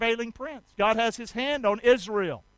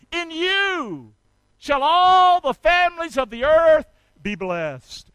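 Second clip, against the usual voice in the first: raised, emphatic, measured declaration; God's proclamation, preached; 4 semitones higher, 5 dB louder than usual. Acted. Preacher voicing God's commission to Abraham, emphatic proclamation. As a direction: proclaim it phrase by phrase, swelling at the end.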